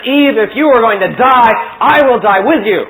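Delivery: loud, earnest vow of devotion; a solemn, loud romantic oath; noble Adam. Acted. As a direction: swear it like a lover who won't leave. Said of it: "great, noble Adam says".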